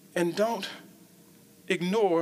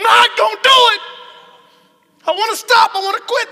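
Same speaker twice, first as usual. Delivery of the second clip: higher, louder shouted confession; preaching shout; 12 semitones higher, 13 dB louder than usual. Acted. Preacher voicing a wish to quit, shouting. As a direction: belt it out.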